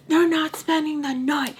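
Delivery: retelling a furious mom refusing the overnight; furious order. Retold or imitated.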